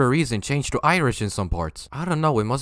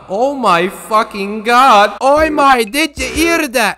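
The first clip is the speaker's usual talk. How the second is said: hammed-up British accent, higher and louder, slipping into Irish; over-exaggerated British accent; 6 semitones higher, 5 dB louder than usual. Mocking the British YouTuber in a hammy British accent. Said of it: "over-exaggerated, stereotypical British accent, which for whatever reason changed to Irish".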